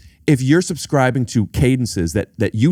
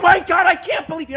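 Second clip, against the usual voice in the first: shrieked high, gushing disbelief; emotional outburst; faster than usual. Shown as a demonstration. Showing a friend how emotion sounds. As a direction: overact the shock, way up high. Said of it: "if you're gonna be personality and emotions, you go".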